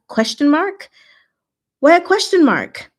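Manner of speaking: high, loud, baffled rise; puzzled surprise. Real, not acted. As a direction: react with loud bewilderment. Reacting to chat punctuation.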